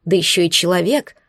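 usual speaking voice

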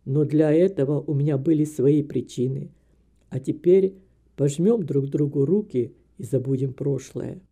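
put-on low older man's voice; conciliatory old man. A narrator's reading. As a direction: offer reconciliation in a deep, measured tone. Narrator voices Graham making peace. (старик мирится, низким голосом)

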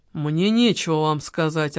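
usual speaking voice

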